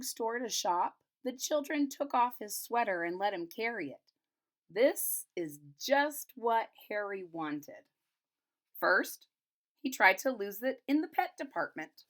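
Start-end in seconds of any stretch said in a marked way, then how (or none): none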